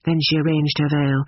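ordinary delivery, nothing marked

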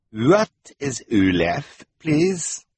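slow, loud fake foreign accent; villain Gunter. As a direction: feign puzzlement, rising on please.

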